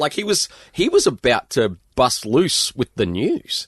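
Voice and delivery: high, loud and slow, savouring the news; building suspense about the big reveal; thrilled, drawing out the words; excited. Real, not acted. Still excited about John Coates.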